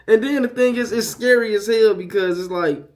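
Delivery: normal talking voice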